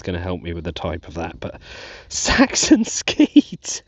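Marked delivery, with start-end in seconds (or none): 2.1-3.8 slow, high, breathy-laughing exclamation; giddy squeal. Real, not acted. Detectorist celebrates his first Saxon coin, high giddy squeal. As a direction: squeal it with pure delight.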